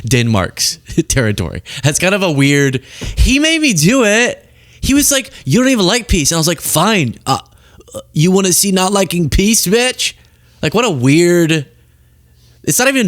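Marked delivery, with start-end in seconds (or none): 3.2-4.5 high-pitched mocking blame-shifter; mock excuse. 5.4-6.3 high-pitched mocking quote; mock accusation. Mock retelling of a childish quarrel. 6.6-10.1 high-pitched mock threat; mock petty comeback.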